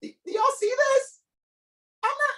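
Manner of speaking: very high squeaky exclamation; mock disbelief. Host squeaking in mock disbelief to his viewers.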